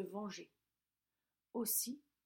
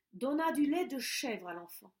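usual speaking voice

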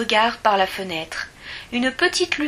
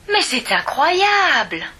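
slow, drawn-out marvelling, a bit higher and louder; a child stunned that her friend already finished; amazed; 5 semitones higher, 4 dB louder than usual. A narrator's reading. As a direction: let the wonder stretch each word. Amazed by the spider's fast knitting.

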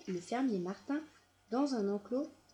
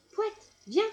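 calling; 11 semitones higher, 7 dB louder than usual. A narrator's reading. Farmer calls dog to him.

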